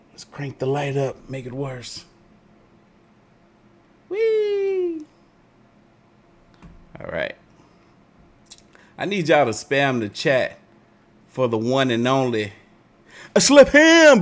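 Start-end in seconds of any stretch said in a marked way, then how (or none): 4.1-5.0 very high gleeful whee; high squeal. Real, not acted. Light cranked up. 13.3-14.2 loud, high hype shout-out; hype announcer. Acted. Hyping the guest.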